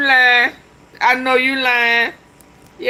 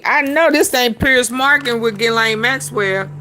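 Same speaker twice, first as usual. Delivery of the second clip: ordinary delivery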